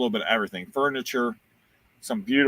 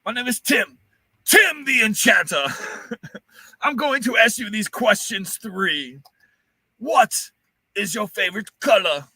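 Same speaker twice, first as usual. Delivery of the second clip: high, slow mock wizard voice; Tim the Enchanter; 9 semitones higher, 4 dB louder than usual. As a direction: intone it like a pompous enchanter. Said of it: "great quotes in Monty Python".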